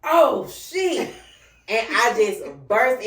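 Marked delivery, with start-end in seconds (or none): 0.0-1.1 high loud stunned curse. Retold or imitated. Ex realizes she is pregnant, high and loud. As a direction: let it burst out high in shock.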